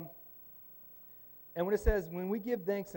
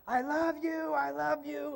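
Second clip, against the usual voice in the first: high, far-off parent yell; high doting parent calling out; parents yelling from the car; 9 semitones higher, slower than usual. Retold or imitated. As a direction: call it out the car window like a proud parent.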